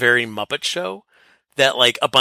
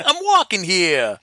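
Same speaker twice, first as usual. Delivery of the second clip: higher, slow New York protest; 6 semitones higher, slower than usual. Retold or imitated. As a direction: call it out like an indignant New Yorker. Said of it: "something that would happen in New York".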